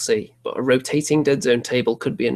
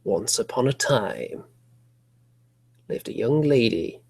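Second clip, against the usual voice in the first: vintage horror narrator; 3 dB quieter than usual. Acted. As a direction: whisper it like an old horror host. Opens the spooky story.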